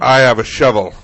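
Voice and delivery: very loud, grand declaration; Gandalf-style resident. Acted. Resident standing off with shovel, booming declaration. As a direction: thunder it like a wizard with a staff. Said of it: "giving their best Gandalf".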